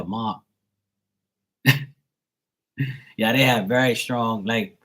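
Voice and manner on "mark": "Mark" with the R left silent; dropped R. Shown as a demonstration. Explaining the local way, "Mark" without its R. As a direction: make "Mark" sound like "Mahk". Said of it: "they don't stress the R".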